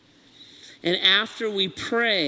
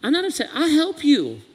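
put-on a loving father soothing his child; gentle comforting God; 7 semitones higher than usual. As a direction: reassure a frightened child lovingly. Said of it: "God would look at you and say".

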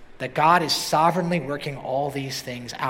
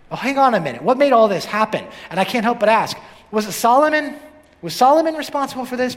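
questions pushed higher and louder, building; rising urgency; 8 semitones higher, 6 dB louder than usual. Real, not acted. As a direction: press the congregation with mounting urgency. Preacher builds rhetorical questions.

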